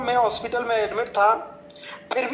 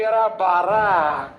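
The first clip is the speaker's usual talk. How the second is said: drawn-out mock groan; 2 semitones lower, 4 dB louder, much slower than usual. Comic exclamation.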